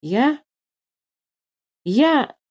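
high questioning start, long pause between; hesitant, taken aback. A narrator's reading. Philipp cannot answer the hard question, hesitant. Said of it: "начал Филипп и понял, что не может ответить".

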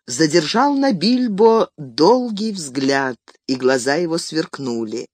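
plain reading voice

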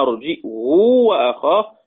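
"أرجئه وأخاه" with glottal stop and long "huu"; hamza, lengthened damma. Shown as a demonstration. Teacher summing up Ibn Kathir's reading, "أرجئه وأخاه" as arji'huu. بهمزة وضم وإشباع.

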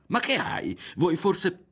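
high-pitched, agitated questioning; flustered stammer. A narrator's reading. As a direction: break off in alarm. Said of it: "balbettò Berto".

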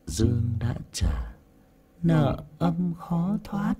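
slow, high distorted demon voice; demonic double voice. Shown as a demonstration. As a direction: draw it out as an eerie ghost. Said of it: "Hoặc là".

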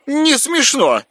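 high sulky retort. Acted. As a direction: pout and snap back. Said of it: "надулся".

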